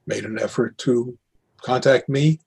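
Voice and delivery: ordinary delivery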